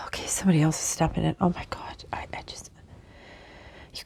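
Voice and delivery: hushed whisper, quickening. Real, not acted. Whispering as another spirit steps in. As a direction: whisper it, thrown off.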